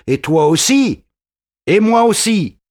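high, loud sneering retort; mocking sergeant. A narrator's reading. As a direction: scoff at the threat. Sergeant mocks Marius's threat. (le sergent se moque, très fort)

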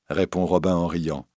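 ordinary delivery, nothing marked

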